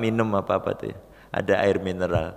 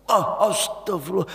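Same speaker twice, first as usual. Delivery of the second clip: startled gasp; 8 semitones higher than usual. Retold or imitated. Startled after near accident.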